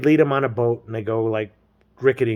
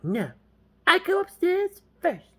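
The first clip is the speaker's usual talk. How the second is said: slow, high falsetto woman; mock girl voice; slower than usual. Girl halts James at the stairs, slow mock falsetto. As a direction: stop him in a drawn-out falsetto.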